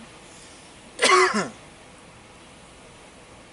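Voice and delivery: loud, high hacking cough; coughing the bug out; just inhaled a bug. Real, not acted. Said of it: "I think I just inhaled a bug".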